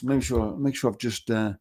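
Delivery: normal talking voice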